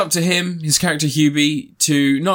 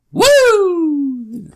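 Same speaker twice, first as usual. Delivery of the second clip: mock whoop; 6 dB louder than usual. Mock cheer after quote.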